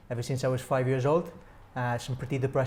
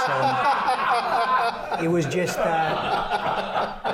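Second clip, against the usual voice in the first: words squeezed through laughter; laughing; 11 semitones higher, 4 dB louder, much slower than usual. Real, not acted. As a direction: laugh while you talk. Laughing at own Fawlty Towers joke.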